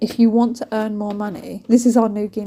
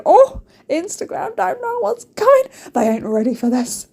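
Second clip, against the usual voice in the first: mock boasting; 10 semitones higher, 4 dB louder than usual. Friends laughing over photos in the car, mock boasting. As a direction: crow it loud and high, hamming it up. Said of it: "we're laughing, going".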